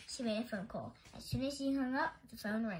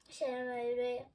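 usual speaking voice

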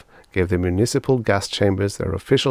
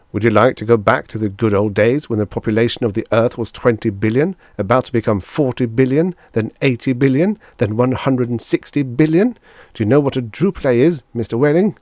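mounting lecture, rising higher and louder; severe; 5 semitones higher, 5 dB louder than usual. A narrator's reading. Doctor lectures father about overpopulation, severe and building. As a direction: build figure on figure, challenging him. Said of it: "became rather severe with Whaling".

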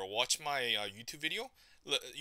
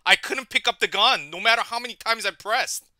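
loud, higher-pitched frustrated rant; loud complaint; 6 semitones higher, 9 dB louder than usual. Real, not acted. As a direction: yell the excuse. Complaining about the gun.

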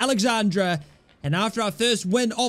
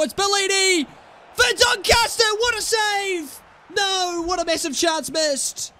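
commentator shout; 11 semitones higher than usual. Acted. Gamer commentates his own shot, high excited shout. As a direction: call the big chance like a TV commentator.

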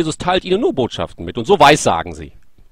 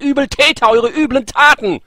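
Jesus condemning; 7 semitones higher, 3 dB louder than usual. Retold or imitated. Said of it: "Nein, er sagt".

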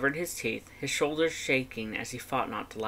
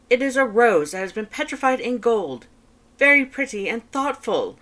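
high, loud, fast boastful skeleton; Papyrus voice; 10 semitones higher, 8 dB louder than usual. Acted. Papyrus reporting on his date to his brother, high and loud. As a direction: rattle it off proudly, high and loud.

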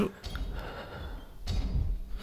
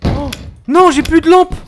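higher, louder alarmed voice; panicked; 10 semitones higher, 5 dB louder than usual. Real, not acted. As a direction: alarm as the light dies. Flashlight dies, panics.

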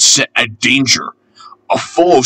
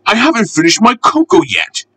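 grumbling; 9 semitones higher than usual. A narrator's reading. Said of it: "the fireman grumbled".

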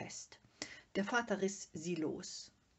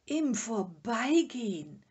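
loud, drawn-out, incredulous cry; outraged exclamation; 5 semitones higher, 11 dB louder than usual. A narrator's reading. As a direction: throw the words back in slow outrage. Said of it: "rief der Vater". (empört und gedehnt rufend)

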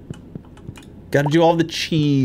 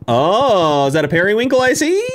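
1 delighted surprise; 7 semitones higher, 4 dB louder than usual. Real, not acted. Spotting a rare item.

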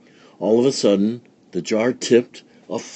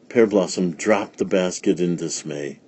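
ordinary delivery, nothing marked

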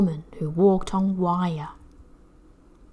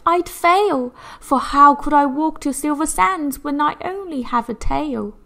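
sighing; 7 semitones higher, 4 dB louder than usual. Acted. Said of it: "the mermaid sighed".

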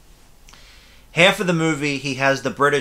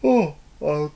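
yawning; 3 semitones higher than usual. Real, not acted. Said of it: "complain about me yawning".

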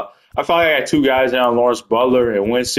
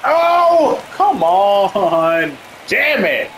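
frustrated outburst; 9 semitones higher, much slower than usual. Real, not acted. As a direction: vent at the bad play. Frustrated at a game play.

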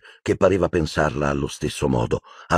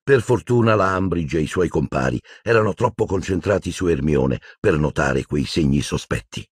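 ordinary delivery, nothing marked

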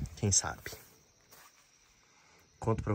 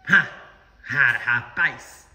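ordinary talk

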